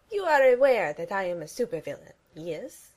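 put-on loud accented villain; puffed-up villain; Gru voice. A narrator's reading.

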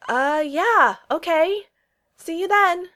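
high, loud, flustered farewell; calling out. A narrator's reading. Steve answers Peggy as she walks away, calling out. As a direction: call after someone walking away. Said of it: "Steve called out".

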